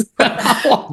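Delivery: short high-pitched giggles. Real, not acted. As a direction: giggle at the joke. Laughter burst.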